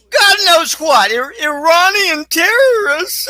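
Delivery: sarcastic, high and loud; mock blame. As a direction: sneer out the official story. Mocking the official blame story.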